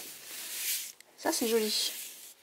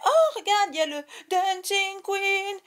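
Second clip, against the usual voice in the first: retold excited exclamation; 8 semitones higher, 3 dB louder than usual. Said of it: "je disais".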